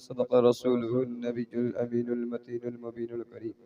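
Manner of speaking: Quranic recitation. Acted. Arabic khutba recitation.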